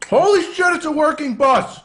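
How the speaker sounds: very high, loud amazed yell; excited shout. Real, not acted. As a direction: shriek with real excitement. Reacting live while playing DayZ.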